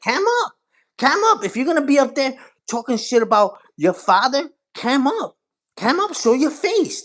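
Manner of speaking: high, insistent demand; challenging call-out. Real, not acted. Streamer daring hidden trash-talkers to show themselves, challenging.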